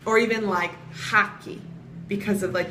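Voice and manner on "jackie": Haki with an H for the J; Haki, breathy H in place of J; Spanish H sound. Shown as a demonstration. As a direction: say Jackie as Haki. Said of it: "that H sound that Spanish".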